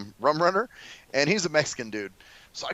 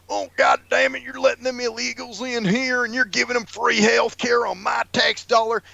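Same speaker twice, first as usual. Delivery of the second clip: higher mock rant; mock angry conservative; 7 semitones higher than usual. Mocking an angry taxpayer, higher mock rant. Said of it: "I don't even get that mad at".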